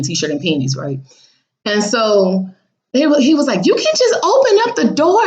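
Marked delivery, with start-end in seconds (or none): 3.7-5.2 high, pushy mock voice; lightly hammed-up nosy father; mimicking the father. Voicing the father telling her to open the door.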